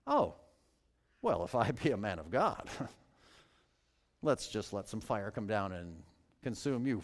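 mock-casual, dry, half laughing; sarcastic Elijah. Shown as a demonstration. Preacher voicing Elijah's mocking answer to the captain, sarcastic and amused. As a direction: shrug off the threat with a chuckle. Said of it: "consider inserting a hint of sarcasm".

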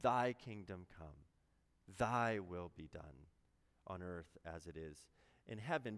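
each "thy" in "Thy kingdom come, thy will be done" leaned on and held; stress on thy. Shown as a demonstration. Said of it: "I try to emphasize the word thy".

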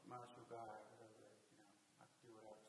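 mock cocky indifference, tossed off softly; low-key, throwaway macho swagger; dismissive macho attitude. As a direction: shrug it off like a too-cool guy. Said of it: "Brock's attitude. It's kind of like".

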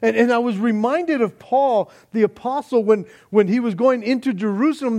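loud, high, urgent warnings; full-force preaching. Acted. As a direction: warn him off, pleading hard.

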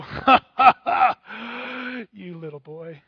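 loud mock laugh then boastful ribbing; hammed-up runner bragging; Mick Daly mocking. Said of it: "To which Mick Daly would go".

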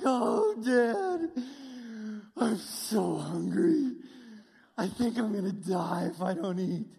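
imitating a daughter's loud, drawn-out whine; hammed-up, slow plea to Dad, as if starving; melodramatic daughter. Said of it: "That's one form of sarcasm".

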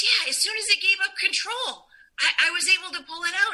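high, quick retold excitement; excited friend. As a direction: pitch up and rush her amazement. Friend voiced, excited retelling.

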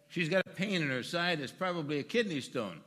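loud, high, self-assured declaration to the doctor; cocky loud know-it-all. Retold or imitated. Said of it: "being the knowledgeable individual".

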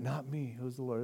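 soft, pious-sounding "Not me", laughing at it; mock false humility. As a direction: play a falsely humble saint, quietly. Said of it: "It was false humility".

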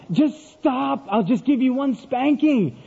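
high, drawn-out exasperated demand; father at his wits' end, pitch way up. Retold or imitated. Father to his wailing son, thoroughly exasperated.

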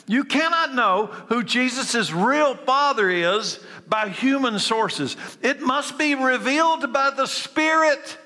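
emphatic preaching. Real, not acted. Preacher stressing the big truth to listeners, emphatic. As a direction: press it on them hard. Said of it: "why I say that with such emphasis".

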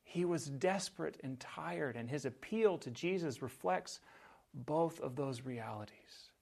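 ordinary delivery

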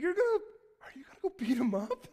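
high, loud, eager rising question; thrilled little brother asking; boy hoping for a fight; excited kid. Retold or imitated. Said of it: "I started getting excited".

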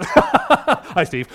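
bursting into high laughter at his own slip; embarrassed real giggling. Real, not acted. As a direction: laugh helplessly at the mistake. Laughing at his slip naming Steve.

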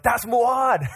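high-pitched excited cry, imitated; excited scream. The ladies spotting their passing ride, excited scream. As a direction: imitate someone spotting the driver and yelling. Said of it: "so they scream at him".

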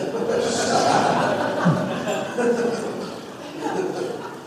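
breathy rising laugh. Real, not acted. Pastor laughing at Adam's excuse, airy laugh.

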